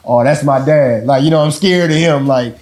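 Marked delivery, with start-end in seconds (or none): none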